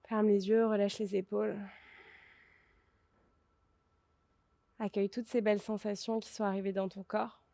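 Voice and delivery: soft, calm closing-meditation voice; guided relaxation. Acted. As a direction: ease them into rest, quietly.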